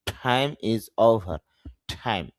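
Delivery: time with a puff of air, thaim; aspirated t. Shown as a demonstration. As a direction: say time with a breathy th. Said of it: "না বলে বলবেন time".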